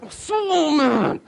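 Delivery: long, high mock scream of anger; inner rage, acted out. Pastor voicing his inside reaction to the smashed window, hammed-up rage. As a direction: stretch it out in a hammed-up high wail. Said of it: "inside, I was like".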